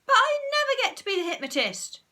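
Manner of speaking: high whiny wail. A narrator's reading. Susan wails at Margaret about never being hypnotist. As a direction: wail it like a hard-done-by child. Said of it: "wailed Susan".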